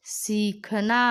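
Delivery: सीखना slow, the सी held long; teacher models सीखना slowly; drawn-out long ई. Shown as a demonstration. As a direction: stretch the ई in सीखना. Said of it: "ई करते समय इस पे ज़्यादा जोर दिया".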